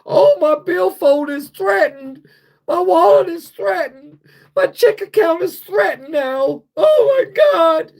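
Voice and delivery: slow, very high, loud mock wail; hammed-up falsetto panic, near a shout; mock greedy politician. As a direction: whine like a rich man losing his wallet. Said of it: "they should say that".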